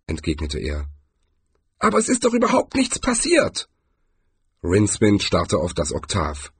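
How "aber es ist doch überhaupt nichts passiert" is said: high-pitched character voice of protest; high, indignant objection; protesting old barbarian. A narrator's reading.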